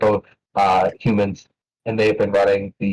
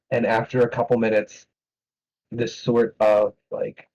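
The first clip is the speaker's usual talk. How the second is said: ordinary talk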